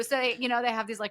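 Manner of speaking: ordinary talk, nothing marked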